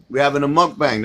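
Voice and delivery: usual speaking voice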